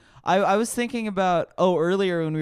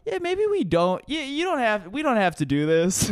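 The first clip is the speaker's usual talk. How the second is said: higher-pitched, hesitant agent; lightly mock an agent backing out; agent's voice; 6 semitones higher than usual. Comedian quotes the agent cancelling the show, high and hesitant. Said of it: "I get an email from my agent".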